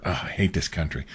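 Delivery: muttered. Retold or imitated. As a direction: mutter it like a sore loser. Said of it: "I could hear him kinda mutter".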